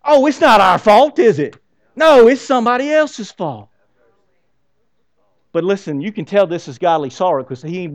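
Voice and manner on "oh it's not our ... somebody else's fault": mock defensive. Mocking the blaming American.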